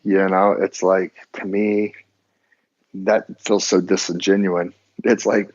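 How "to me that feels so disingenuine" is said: ordinary talk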